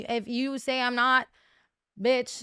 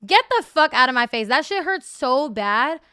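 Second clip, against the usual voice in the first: heated complaint; 3 semitones higher, 7 dB louder than usual. Real, not acted. Recalling the pain, heated.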